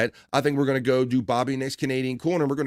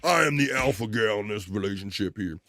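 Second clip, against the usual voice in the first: playing up a menacing alpha gal for the chat; mock alpha gal. Host jokingly threatens the chat as an alpha gal, mock tough.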